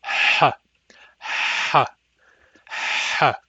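raspy breathy ha from the throat; back H demo. Shown as a demonstration. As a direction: push a noisy throat H three times. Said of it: "makes a noisy, raspy sound like this".

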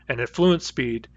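ordinary talk

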